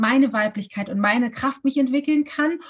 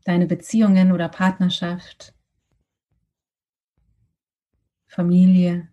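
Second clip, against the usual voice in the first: guided meditation; 3 semitones lower than usual. Acted.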